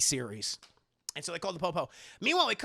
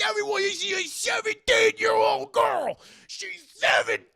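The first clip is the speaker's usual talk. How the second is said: very high, loud alarmed yell; panicked shout; 14 semitones higher, 7 dB louder than usual. Retold or imitated. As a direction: announce the shock to the whole party. Guy freaks out, announces.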